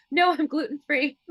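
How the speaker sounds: mock-polite no through a laugh; laughing refusal. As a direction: turn the bread down while laughing. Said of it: "I'm like".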